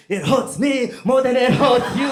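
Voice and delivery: mock the stern dad's pious line. Dad's line before a beating.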